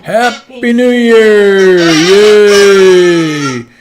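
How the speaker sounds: loud high cheering shout; new year countdown crowd. Real, not acted. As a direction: cheer it out at midnight. Midnight cheer on stream.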